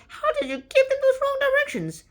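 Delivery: very high, loud mock-shocked squeal; aghast outcry. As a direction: cry out in hammed-up disbelief, pitch way up. Crew aghast at deadly wrong directions.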